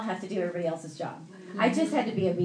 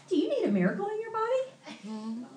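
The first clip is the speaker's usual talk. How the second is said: high-pitched mimicked question, rising at the end; earnest evangelist friend; 11 semitones higher than usual. Friend asks cashier out of nowhere, high mimicked question. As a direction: imitate her asking sweetly high.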